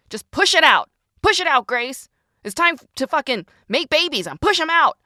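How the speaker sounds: high, loud rallying cry; forceful self-urging. Acted. Writer urging herself to get the work out, forceful. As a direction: push hard, like a birth coach.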